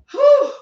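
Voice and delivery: high, loud blown-out whew; sharp relieved exhale; exasperated whew. Real, not acted. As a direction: blow it out like letting off steam. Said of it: "I'm gonna take a deep breath".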